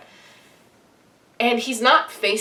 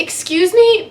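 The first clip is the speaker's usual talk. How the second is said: sharp, higher, louder excuse me; accusatory; 9 semitones higher, 7 dB louder than usual. Retold or imitated. Fan stopping the actor sounds accusatory. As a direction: call out excuse me like a scolding. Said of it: "might have come out, like, a little accusatory".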